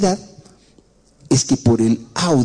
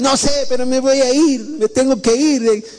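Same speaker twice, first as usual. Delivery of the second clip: higher, whiny insistence; a stubborn kid who must leave; joven terco; 9 semitones higher than usual. Retold or imitated. (joven terco con voz aguda y quejosa)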